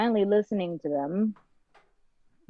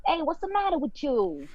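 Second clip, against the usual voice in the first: higher-pitched Boston accent; 8 semitones higher than usual. Shown as a demonstration. Guest answers the hosts' request, Boston accent. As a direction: confront someone in a Boston accent. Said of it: "Can you do a Boston?".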